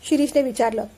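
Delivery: plain reading voice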